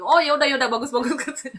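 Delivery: imitated giving in, amused; laughing concession. Mentor giving in to the new believer, laughing concession.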